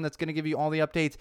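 usual speaking voice

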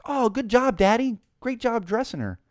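high, exaggerated mock praise; gushing older cashier. Checker congratulating a dad on dressing his daughter, patronizingly delighted. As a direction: praise him like a small child, imitating the lady. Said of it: "She goes".

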